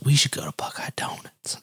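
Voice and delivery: put-on voiceless, murmured drunken whisper. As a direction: whisper it like a tipsy friend plotting. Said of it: "He goes".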